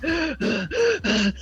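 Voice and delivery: imitated winded gasping; audible, acted-out panting; out-of-breath gasps. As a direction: heave for air before speaking. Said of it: "literally here's how it went".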